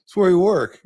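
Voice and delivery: loud mock snap back, rising high; retold defiant shout; defiant retort. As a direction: fire it back at him. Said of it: "And Molly goes".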